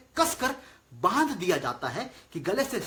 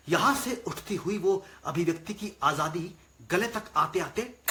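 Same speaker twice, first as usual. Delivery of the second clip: ordinary talk, nothing marked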